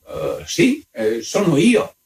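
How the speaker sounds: high-pitched, halting admission; hesitant countess. A narrator's reading. Countess hesitantly admits, peering at paper.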